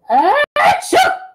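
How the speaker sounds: high, loud mock sneeze. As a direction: blast out a fake sneeze. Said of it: "she shouted".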